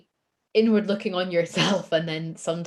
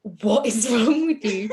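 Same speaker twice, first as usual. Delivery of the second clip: laughing disbelief; 5 semitones higher, 6 dB louder than usual. Retold or imitated. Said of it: "Nikki was just sat looking at me like".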